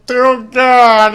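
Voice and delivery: loud, high, hammed-up wail; mock wailing. Teacher imitates wife watching a tearjerker with violins, mock wailing. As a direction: imitate a wife overcome by a sad film. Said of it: "your wife's in there going".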